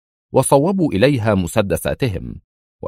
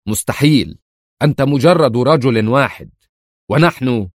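sharp, higher, louder protest; sharp outburst; 4 semitones higher, 3 dB louder than usual. A narrator's reading. Agent protests against Adham's threat, sharply. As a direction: blurt out disbelief, cut off mid-word. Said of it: "هتف الرجل في حدة".

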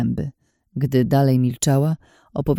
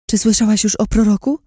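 higher-pitched, urgent questioning; frenzied zealot; 7 semitones higher, 4 dB louder than usual. A narrator's reading. Frenzied woman accosting a stranger about the prophet, urgent. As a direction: press a stranger with fanatical eagerness. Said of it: "Mówiła zbyt szybko".

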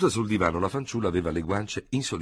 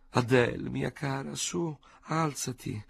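coaxing softly; 7 semitones higher, slower than usual. A narrator's reading. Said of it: "Léonie coaxing the girl".

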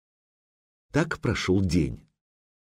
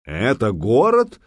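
scornful snort; 3 semitones higher, 4 dB louder, slower than usual. A narrator's reading. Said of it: "фыркнул Медвежья Лапа".

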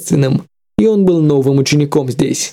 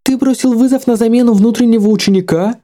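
put-on startled, higher young woman's voice; surprised; 7 semitones higher than usual. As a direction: ask it in open surprise. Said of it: "Юнь Яо была удивлена".

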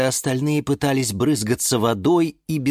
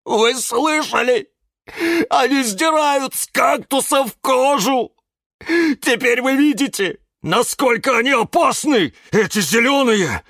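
high, horrified wail; Кирилл moaning; 13 semitones higher, 4 dB louder than usual. A narrator's reading. Cactus warning plants about the leather makers, horrified moaning. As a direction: lament it in alarm. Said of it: "простонал кактус Кирилл".

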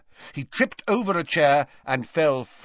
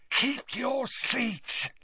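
high, slow, soft hissing warning; warning Parseltongue; 13 semitones higher, 6 dB quieter, much slower than usual. A narrator's reading. Father warns Morfin to stay seated, warning. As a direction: warn in a drawn-out serpent voice. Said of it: "said his father warningly in Parseltongue".

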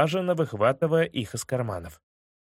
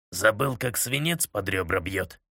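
stony voice; 5 semitones lower than usual. Acted. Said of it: "Каменным голосом ответил Кубату".

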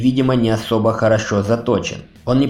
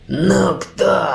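wheezing rasp, pitched high; hoarse growl; 9 semitones higher than usual. A narrator's reading. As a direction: rasp it out like a wounded fighter. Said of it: "прохрипел Ури".

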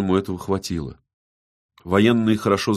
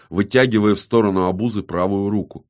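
usual speaking voice

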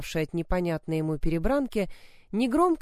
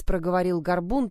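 usual speaking voice

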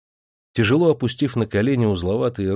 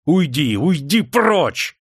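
higher and slow on прочь; angry shout; 6 semitones higher, 3 dB louder, much slower than usual. A narrator's reading. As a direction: drive the old woman away. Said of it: "Демидов отдёрнул руку и закричал". (гневный крик)

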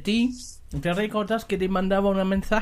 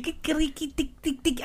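high, soft sing-song Chilean posh voice; mock posh Chilean; 9 semitones higher, 6 dB quieter than usual. Shown as a demonstration. [name] mocking how posh Chileans talk, high sing-song posh Chilean. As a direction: chirp it like a posh Santiago kid. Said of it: "así hablan".

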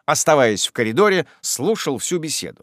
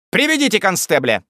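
raised, commanding call; decisive order; 6 semitones higher, 3 dB louder than usual. A narrator's reading. The actor sends the servant for police, decisive order. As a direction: demand it firmly.

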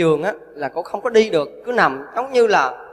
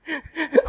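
high laugh; 9 semitones higher, 5 dB quieter than usual. Real, not acted. Monk laughs at the tale.

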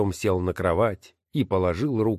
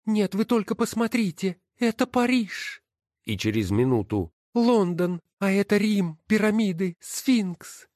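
high, delighted woman's voice; amazed; 12 semitones higher than usual. Acted. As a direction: marvel at the sights. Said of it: "Голос был изумлённый". (изумлённо, высоким голосом)

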